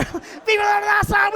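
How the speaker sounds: loud, high rallying cry; festive shout. Retold or imitated. Drivers celebrate the man who laughed, high festive shout. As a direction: cheer it like a street party.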